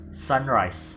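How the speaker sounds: "sunrise" with SUN carrying main stress; slow "sunrise", leaning on SUN. Shown as a demonstration. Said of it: "stress is in the first element".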